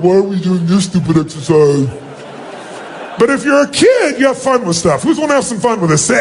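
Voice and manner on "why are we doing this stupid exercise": grumpy adult. Retold or imitated. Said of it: "like an adult, you're like".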